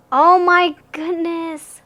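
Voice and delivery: high, loud, slow gasp of amazement; overwhelmed surprise. Real, not acted. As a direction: stretch it out, stunned by the card. Sees the tarot card.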